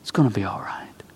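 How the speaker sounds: breathy reassuring whisper. Acted. Angel comforting frightened Hagar, whispering.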